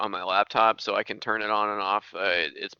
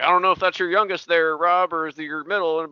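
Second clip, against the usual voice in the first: governor's question lightly voiced; 6 semitones higher, 4 dB louder than usual. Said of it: "so he was like".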